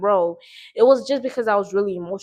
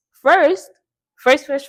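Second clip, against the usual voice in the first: excited; 7 semitones higher, 8 dB louder, much slower than usual. Real, not acted. Eager start to her story.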